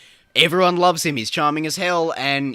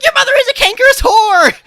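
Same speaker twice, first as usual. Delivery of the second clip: high, loud mock screaming; hammed-up shrieked insult; screamed insult; 15 dB louder than usual. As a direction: yell the insult at the top of your lungs. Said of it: "he's like".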